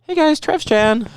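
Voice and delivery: high, slow put-on greeting; mock greeting. Host joins the bad impression game, mock high greeting. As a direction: stretch out a high-voiced hello.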